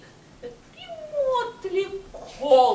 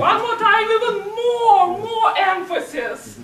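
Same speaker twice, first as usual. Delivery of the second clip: urging; 5 semitones higher, 9 dB louder than usual. Real, not acted. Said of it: "coach urging louder, laughing".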